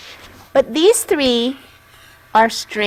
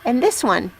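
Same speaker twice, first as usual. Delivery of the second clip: usual speaking voice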